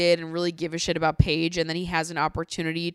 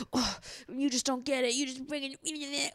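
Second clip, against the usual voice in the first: mock huffing Jax; 6 semitones higher, 7 dB quieter than usual. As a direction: huff it out like a pouting man. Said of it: "he was huffing and puffing".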